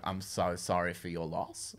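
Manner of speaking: quiet, slow, unintentionally sarcastic; naturally sarcastic. Retold or imitated. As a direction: offer sympathy that sounds insincere. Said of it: "my voice is naturally sarcastic".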